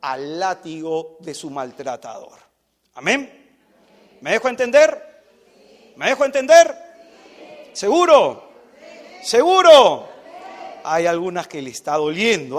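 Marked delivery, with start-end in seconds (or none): 3.0-10.1 loud, insistent check-in; calling out. Real, not acted. Preacher asking congregation if he is understood, loud call. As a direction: call to the crowd for an answer.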